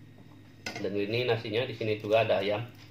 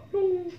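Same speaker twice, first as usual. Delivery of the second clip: high plop sound. Acted. Dropping food in bowl again.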